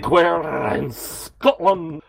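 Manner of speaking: slow, drawn-out mock Glasgow accent; a deliberately terrible Glasgow accent, slow and high; bad Scottish accent. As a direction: ham up a Glasgow accent for the title, badly. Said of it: "that terrible accent".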